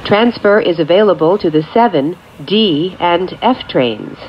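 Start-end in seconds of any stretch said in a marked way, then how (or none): none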